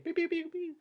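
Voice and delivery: high quiet beeping sound; voiced beeping. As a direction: mimic electronic beeps. Gunfire sound effect voiced.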